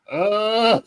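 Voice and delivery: high, long-held "uh"; imitated stunned "uh". Imitating other people at the table, stunned "uh". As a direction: mimic dumbstruck tablemates.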